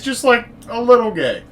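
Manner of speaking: high-pitched teasing lilt. Acted. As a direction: lift it high and playful. Teasing description of Connery's new phase.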